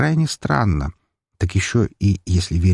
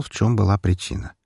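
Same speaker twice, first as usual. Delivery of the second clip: ordinary delivery, nothing marked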